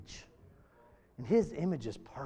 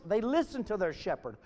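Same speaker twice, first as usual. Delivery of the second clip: ordinary delivery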